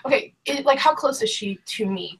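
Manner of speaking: ordinary talk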